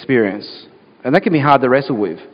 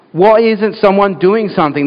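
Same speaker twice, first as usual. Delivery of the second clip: mild mock fuming; 6 semitones higher than usual. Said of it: "they're saying to Jesus".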